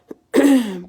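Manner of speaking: a sore, loud throat clear. Real, not acted. Sick vlogger, clearing a sore throat.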